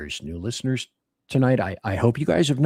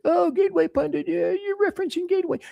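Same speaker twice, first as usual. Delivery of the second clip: mocking high voice; faster than usual. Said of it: "mocking critics".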